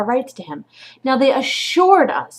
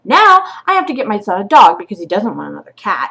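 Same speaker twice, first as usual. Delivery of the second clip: ordinary talk, nothing marked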